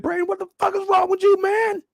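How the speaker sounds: shrill-voiced angry dad hollering; dad yelling upstairs. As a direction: yell up the stairs at your son. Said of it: "His pops calling upstairs like".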